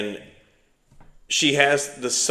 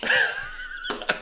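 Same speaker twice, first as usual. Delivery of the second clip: a very high shriek of laughter; high squeal of laughter; 5 dB quieter than usual. Real, not acted. After telling the code word, a high laughing squeal. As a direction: squeal with delight.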